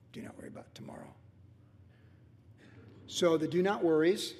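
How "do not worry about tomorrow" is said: near-whisper, very soft; hushed emphasis. Real, not acted. Preacher repeats the big command to congregation, hushed. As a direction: drop the verse to a hush.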